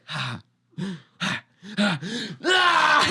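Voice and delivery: high, loud hurt laugh that breaks into a cry; pained laugh turning to yell. Retold or imitated. After being punched, freaking out.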